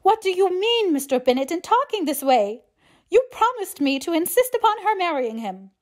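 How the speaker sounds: high, loud, aggrieved matron; agitated high-pitched reproach; indignant disappointment. A narrator's reading. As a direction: protest as a let-down wife. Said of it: "was excessively disappointed".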